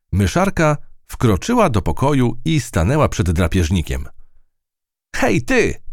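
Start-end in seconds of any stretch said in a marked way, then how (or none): 5.1-5.8 a high, bold cry at the cat; the tiny mouse shouting at the cat, high. Acted. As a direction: call out high and cheeky. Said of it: "krzyknęła".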